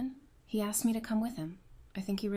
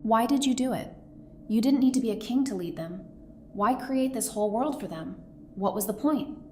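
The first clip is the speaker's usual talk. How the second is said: raised voice, insistent questioning; urgent challenge; 2 semitones higher, 5 dB quieter than usual. Acted. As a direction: press them hard for an answer. Pressing the king with questions.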